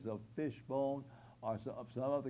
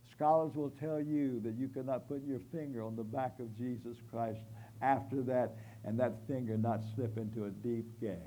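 usual speaking voice

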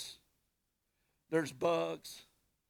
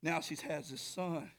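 usual speaking voice

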